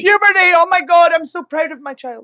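high, loud gushing parent; mock proud parent. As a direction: gush like a proud mum.

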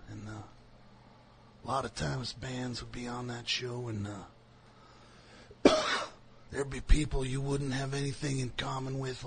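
soft, high Dust Bowl drawl; mock folksy drawl from the Dust Bowl; [name] drawl. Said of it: "I don't know where that accent came from".

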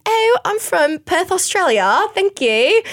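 put-on British accent, high and drawn out; fake British accent. Telling a bouncer she's from Perth, fake British accent. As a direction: do a bad British accent posing as Australian. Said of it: "use a fake British accent".

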